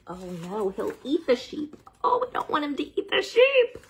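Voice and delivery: high, fast, playful panic; mock alarm. Reader pretends to panic about the sheep. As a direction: squeal in pretend worry.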